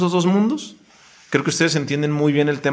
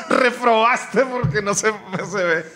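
laughing; 7 semitones higher, slower than usual. Real, not acted. Teasing the host about his accent.

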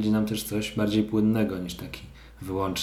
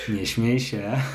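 stifled giggles; 3 semitones higher, much slower than usual. Real, not acted. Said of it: "Czemu się śmiejesz?".

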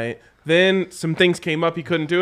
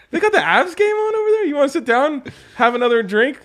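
much higher, eager pitch; excited high pitch; 9 semitones higher than usual. Pretend. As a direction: sell it with playful excitement. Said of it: "you look through the windows".

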